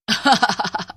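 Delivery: wicked cackle; scary witch laugh. Acted. Witch turns woman again next morning, fearsome cackle. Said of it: "भयानक हंसी हंसने लगती".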